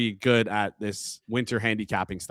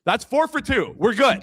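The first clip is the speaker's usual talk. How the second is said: "That's four for two" sung out high and triumphant; excited; 10 semitones higher than usual. Real, not acted. Celebrating early-spring groundhogs to co-host, excited. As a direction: cheer the tally like a win.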